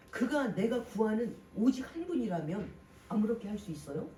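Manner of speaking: ordinary talk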